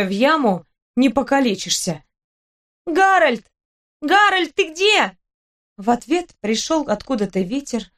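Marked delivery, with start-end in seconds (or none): 2.9-5.1 high, anxious call into the dark. Acted. Girl lost in the dark calls for Harold, calling out high. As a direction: call high for a missing companion.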